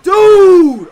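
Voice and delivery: sudden full-throated high yell of disbelief; shocked shout. Real, not acted. Streamer yelling at an opponent's crazy play, a real shocked shout. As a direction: scream it out at the screen.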